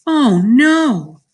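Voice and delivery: loud, high, drawn-out dismay; cried out. A narrator's reading. Mother learns the teeth are gone, loud dismayed cry. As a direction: wail it as a horrified mother. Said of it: "my mother cried".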